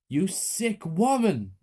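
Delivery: high, very slow outraged words; disgusted accusation. A narrator's reading. Teacher to the murderous mother, outraged. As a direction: spit out the accusation.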